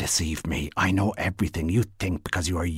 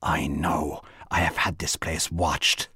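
ordinary delivery

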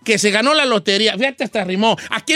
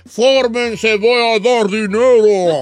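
slow, drawn-out crowd summons; calling out; 4 dB louder, much slower than usual. Acted. What people hear when he announces winning, called out. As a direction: call everyone into line. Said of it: "y ellos escuchan".

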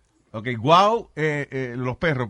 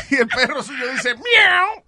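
high, loud cat cry; mock meowing dog; 12 semitones higher, 8 dB louder, slower than usual. Warning listeners about their dog, mock meow.

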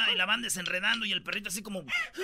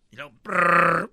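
motorbike crash noise; 2 semitones lower, 11 dB louder, slower than usual. Retold or imitated.

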